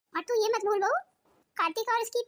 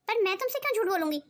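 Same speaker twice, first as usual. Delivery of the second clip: usual speaking voice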